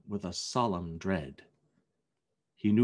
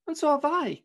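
put-on much higher, loud boy's cry; high, raised young voice; Peter; 5 dB louder than usual. A narrator's reading. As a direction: call out eagerly as a boy. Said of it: "cried Peter".

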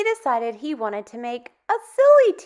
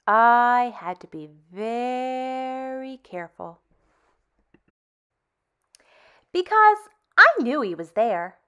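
put-on reading to children; 4 dB louder than usual. Teacher reading a picture book to kids, lively and expressive. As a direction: tell it like a bedtime story to little ones.

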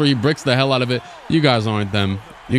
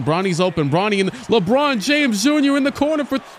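excited play-by-play; 7 semitones higher than usual. Real, not acted. Streamer calling his team's finals game live, rising excited play-by-play. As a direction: pitch climbing higher and higher as he calls each shot.

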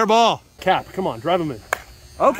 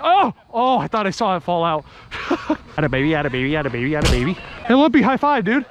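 ordinary talk, nothing marked

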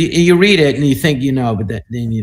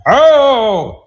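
high, loud declaimed cry; imitated thespian; 9 semitones higher, 5 dB louder than usual. Student imitating his acting professor, declaiming loudly. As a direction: imitate a grand Shakespearean opening. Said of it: "So I could imitate him".

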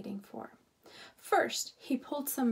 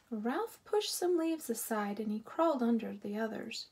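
ordinary delivery

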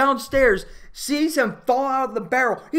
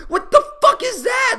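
high, loud outburst of disbelief; appalled shout; 7 dB louder than usual. Real, not acted. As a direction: shriek it in horrified disbelief. Appalled outburst at gruesome scene.

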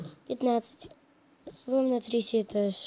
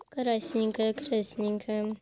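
ordinary talk, nothing marked

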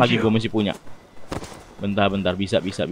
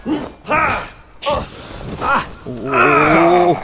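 pained grunts; much slower than usual. Real, not acted. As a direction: grunt as each hit lands. Taking hits from the boss.

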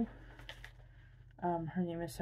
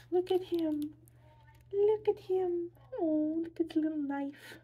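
excited squeal; 10 semitones higher, 3 dB louder than usual. Real, not acted. Gushing over the mini figure.

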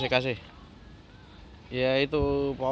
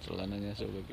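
laughing; 9 dB louder than usual. Real, not acted. Laughing about the torn pants.

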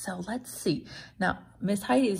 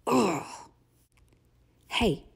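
ordinary delivery, nothing marked

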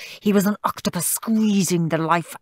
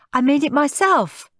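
put-on high, pleased child; high goody-goody pride; proud little brother; 9 semitones higher, 3 dB louder than usual. A narrator's reading. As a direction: announce it proudly, pitched up. Perfect child proud of present.

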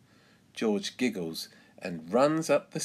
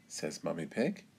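ordinary delivery

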